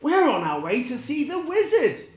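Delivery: put-on high, quick, bright girl voice; Dorothy explaining. Dorothy telling the Tin Man where they're going, high and quick. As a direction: tell him the plan eagerly. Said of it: "she said".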